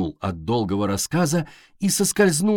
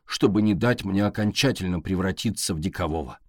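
ordinary delivery, nothing marked

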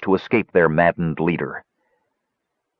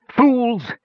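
high shrill shriek. A narrator's reading. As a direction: shriek the insult at them. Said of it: "he shrieked".

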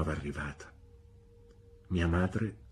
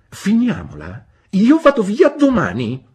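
very high, loud huffy threat; offended outburst; 13 semitones higher, 13 dB louder than usual. A narrator's reading. Spinster threatening her brother to leave, high and loud. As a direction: storm off in wounded pride.

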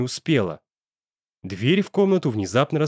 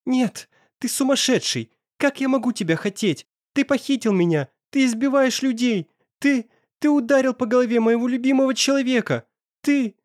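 frantic; 12 semitones higher than usual. Acted. Terrified captive pleading with kidnapper.

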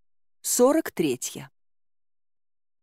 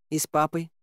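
успокаивающе; 2 semitones lower than usual. Acted. Героиня успокаивает царевну. (спокойное тихое подтверждение)

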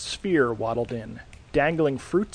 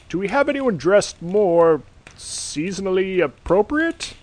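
higher, louder Santa aside; a fed-up Santa turning to his elf; exasperated Santa; 6 semitones higher, 4 dB louder than usual. Acted. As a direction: Santa asking the elf for better candidates.

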